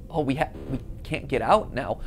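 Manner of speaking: ordinary delivery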